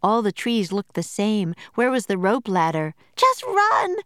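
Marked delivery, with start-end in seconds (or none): 3.2-4.0 high, loud urgent shout; frantic cry. A narrator's reading. Annie orders Jack to run, frantic shout. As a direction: scream at him to go. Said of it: "cried Annie".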